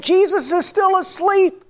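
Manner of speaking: high, frantic cry of terrified sailors; panicked scream. Retold or imitated. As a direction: shout it in panic over the storm. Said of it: "and they're screaming".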